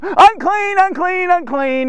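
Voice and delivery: high repeated warning cry, easing down; acted scream. Lepers warning passers-by, acted high calls. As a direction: cry it out high, then let it fall. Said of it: "And so they're screaming".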